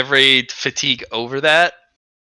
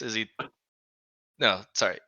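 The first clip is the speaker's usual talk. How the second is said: ordinary talk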